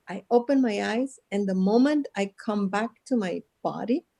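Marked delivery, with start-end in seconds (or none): none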